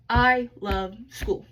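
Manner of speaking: slow and loud, each word set apart; teacher modelling word-by-word steps; stepping out words. Shown as a demonstration. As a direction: step out I love school word by word. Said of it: "How many steps did we take?".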